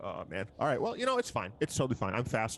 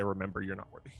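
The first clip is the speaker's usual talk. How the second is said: parting jab; 6 semitones lower, 5 dB quieter, faster than usual. Acted. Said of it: "start walking away and say".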